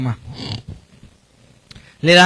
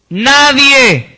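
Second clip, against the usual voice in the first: loud, higher, drawn-out single word; ringing pulpit emphasis; emphatic preaching; 4 semitones higher, 4 dB louder than usual. Real, not acted. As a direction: proclaim it forcefully to the congregation. Preacher hammers the point.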